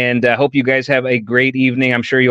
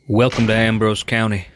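folksy Texan narrator voice; a Texan host welcoming you to the county; Texas accent; 3 semitones lower, 5 dB quieter than usual. Acted. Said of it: "That's the Texas accent".

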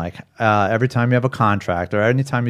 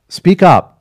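louder, higher urge to speak up; emphatic urging; 7 semitones higher, 8 dB louder than usual. Real, not acted. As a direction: push the point hard. Urging people to complain openly.

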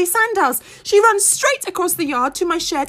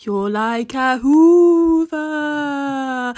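put-on slow, drawn-out older woman's voice; a slow, knowing farmer woman; Missus Jingle Jangle's voice; 3 semitones lower, much slower than usual. A narrator's reading. Remembered farmer woman teasing the pig, slow and knowing. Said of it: "it says".

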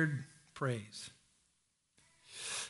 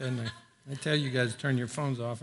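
usual speaking voice

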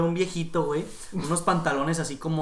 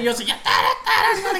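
humming the tune; 6 dB louder than usual. Retold or imitated. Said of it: "haciendo sonidos de música".

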